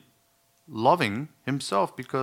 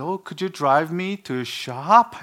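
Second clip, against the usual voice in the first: slow, higher mock wife voice; wife asking; 6 semitones higher, 5 dB louder, slower than usual. Wife asking her husband for a ride, slow higher mock voice. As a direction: ask sweetly, drawn out and pitched up. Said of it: "when my wife said".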